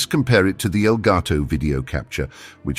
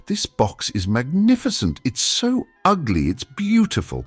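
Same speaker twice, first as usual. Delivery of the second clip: rising giddy delight, much higher; excited; 8 semitones higher than usual. Real, not acted. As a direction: gush over it, pitch climbing. Gushing over the ugly box.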